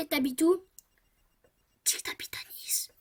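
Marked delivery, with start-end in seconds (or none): 1.8-2.9 breathy unvoiced prompt; whisper. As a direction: slip him the answer under your breath.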